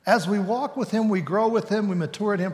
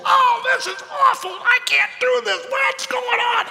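high, far louder panicking voice; panicky flesh; 4 dB louder than usual. Acted. The preacher's flesh panicking at a test, high shout. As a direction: shriek it out like the frightened flesh. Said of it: "But my flesh says".

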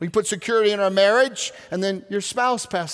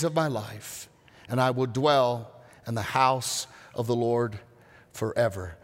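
psalm reading; 4 semitones lower, 3 dB quieter than usual. Acted. Reads psalm slowly and softly.

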